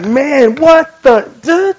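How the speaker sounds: high-pitched stammering bafflement; acted disbelief. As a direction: splutter as if unable to believe it. Acts out disbelief at a sermon.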